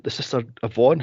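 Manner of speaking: ordinary delivery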